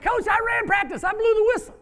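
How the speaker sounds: put-on the boy boasts happily, grinning; proud smiling boy. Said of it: "looked at me and smiled and said".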